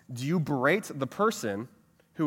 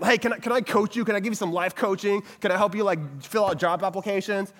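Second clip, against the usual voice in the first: pushy life coach; 5 semitones higher than usual. Retold or imitated. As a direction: badger them with help. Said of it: "kinda berate them".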